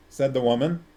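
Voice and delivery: usual speaking voice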